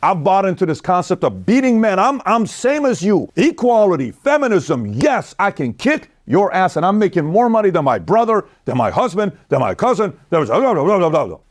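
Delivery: high, mocking voice of a boastful wife; mocking career woman. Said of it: "you're like".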